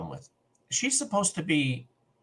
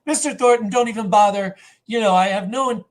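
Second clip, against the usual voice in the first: higher, louder mock protest; retold brush-off; 7 semitones higher, 7 dB louder than usual. MacGyver refusing Mister Thornton's request, retold as a raised brush-off.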